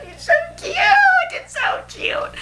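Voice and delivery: very high, strained gushing squeak; fangirl squeal. Real, not acted. Fan overwhelmed by how adorable Gus is, squealing. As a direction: squeal helplessly over how cute he is.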